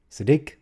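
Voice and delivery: "Siddiq" said si-DIQ for a learner; stress on second syllable. Shown as a demonstration. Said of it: "emphasis on the second syllable".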